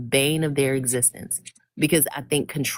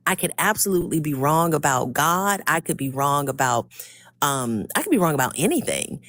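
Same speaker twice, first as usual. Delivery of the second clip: usual speaking voice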